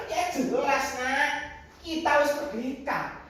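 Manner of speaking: high, confident answer; emphatic assurance. Acted. Grandfather assures grandchild of freedom, emphatically. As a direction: declare it as beyond doubt.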